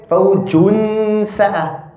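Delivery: Fawjun sa'a very slowly, noon hidden with gunna; slow recitation demo. Shown as a demonstration. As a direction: drag out Fawjun with a nasal hum. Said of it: "How do you say it?".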